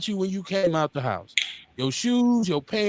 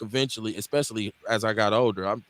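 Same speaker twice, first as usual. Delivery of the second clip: ordinary delivery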